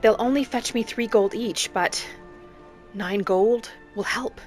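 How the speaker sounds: normal talking voice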